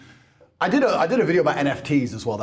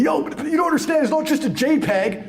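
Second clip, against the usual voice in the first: mock crypto bro; 7 semitones higher than usual. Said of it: "people were saying to me".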